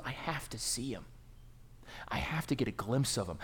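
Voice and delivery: soft, breathy whisper of longing; hushed longing. Acted. Zacchaeus longing to glimpse Jesus, whispering. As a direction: whisper it like a secret hope.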